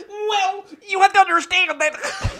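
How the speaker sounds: very high, loud laughing voice; heightened high voice. Retold or imitated. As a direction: squeak it like a cartoon character. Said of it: "If I were to do an impression of us".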